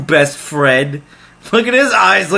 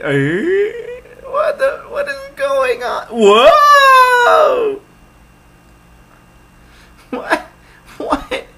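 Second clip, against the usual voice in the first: baffled yelps; much slower than usual. Real, not acted. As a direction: yelp in stunned confusion. Reacts to bizarre game event.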